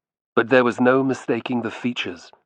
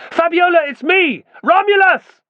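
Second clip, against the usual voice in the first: much higher, louder desperate scream; 7 dB louder than usual. A narrator's reading. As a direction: scream to be heard across the crowd. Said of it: "Romulus screamed the same words".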